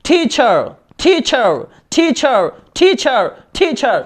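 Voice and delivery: "টিচার" with a puffed t, falling at the end; aspirated T drill. Shown as a demonstration. Teacher drilling the new T, aspirated "টিচার". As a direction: repeat "টিচার" slowly with an airy th-like t. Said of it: "তাহলে টিচার বলা যাবে না".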